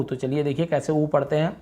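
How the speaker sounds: normal talking voice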